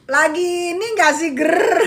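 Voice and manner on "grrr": mock growl. Louder growl.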